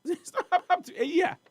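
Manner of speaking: high-pitched laugh through the words; incredulous laughing. Real, not acted. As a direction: laugh it out in disbelief, voice shooting up. Laughs in disbelief at company's age.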